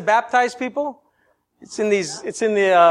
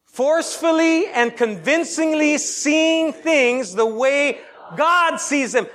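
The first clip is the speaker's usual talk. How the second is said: slow preached recitation; 4 semitones higher, slower than usual. Shown as a demonstration. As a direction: preach every word slowly to the crowd. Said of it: "Let's say this together".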